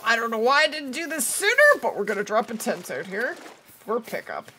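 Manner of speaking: ordinary talk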